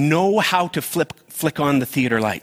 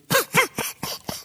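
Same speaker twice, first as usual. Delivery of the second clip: high laugh. Real, not acted. Laugh at movie crying.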